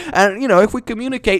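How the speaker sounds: ordinary delivery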